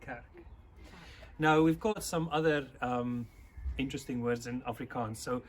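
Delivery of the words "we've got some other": ordinary talk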